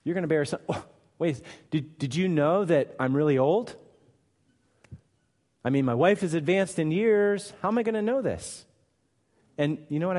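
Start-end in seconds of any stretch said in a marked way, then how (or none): 0.0-8.6 incredulous Zechariah. Acted. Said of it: "As if Zechariah needed to inform Gabriel".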